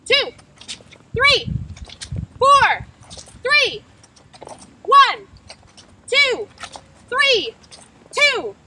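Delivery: loud, high shouted numbers, each one falling; calling out lane numbers. Shown as a demonstration. Skater calling partner's lane during the drill, loud. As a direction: yell every lane like you're over a crowd. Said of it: "being nice and loud".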